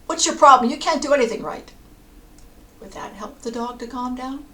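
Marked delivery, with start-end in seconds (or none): 0.1-1.7 loud, high scolding yell; furious acted telling-off, hard and loud; angry yelling. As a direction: yell at the dog as if fed up. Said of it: "Would you yell at the dog".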